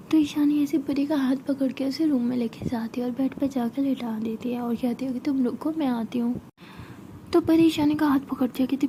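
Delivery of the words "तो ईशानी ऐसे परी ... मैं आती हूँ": hushed bedtime whisper, barely voiced; soft night-time whisper to a sleepy girl. A narrator's reading. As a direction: whisper it so nobody wakes. Night, putting Pari to bed.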